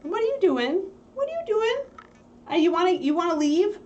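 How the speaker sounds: high cooing pet voice. Real, not acted. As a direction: coo at the puppy. Talking to puppy.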